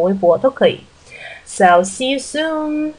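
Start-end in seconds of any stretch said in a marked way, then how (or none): 1.4-2.9 So see you soon said slowly and lifted; drawn-out friendly goodbye; slow sign-off. Real, not acted. Host says goodbye to listeners, slow and lilting.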